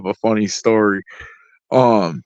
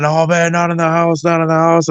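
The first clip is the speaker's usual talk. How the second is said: retold shouting, pitched higher; mock yell; 6 semitones higher than usual. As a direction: echo the crowd's pleading yell, not at full volume. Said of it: "Everybody's yelling".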